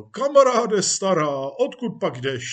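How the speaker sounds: hearty loud hail, easing at the end; calling out. Acted. Police chief asks his old friend, calling out. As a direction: call jovially to an old pal.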